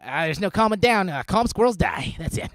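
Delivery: high, loud, frantic squirrel; hammed-up panicking squirrel. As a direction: refuse to calm down, in a worked-up animal voice. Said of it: "a squirrel is an emotional support animal".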